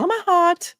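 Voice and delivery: high, gushy, sweet; gushing voice. Real, not acted. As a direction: gush it out high. Gushing thanks to winner.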